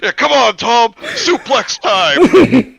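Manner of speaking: hyped wrestling shout. Acted.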